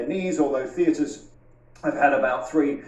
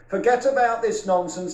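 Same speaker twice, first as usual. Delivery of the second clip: normal talking voice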